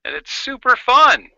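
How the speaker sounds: excited. Real, not acted. Excited show and tell.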